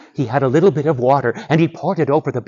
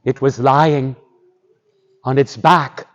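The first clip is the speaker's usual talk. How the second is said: usual speaking voice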